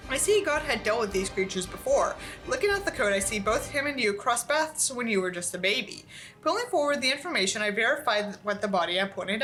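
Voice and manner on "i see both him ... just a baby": ordinary talk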